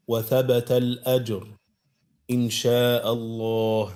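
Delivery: ordinary talk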